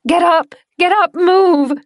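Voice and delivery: high, loud yelling orders; girl screaming her friend awake; frantic scream. Acted. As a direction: scream at him to move. Screaming at him to move.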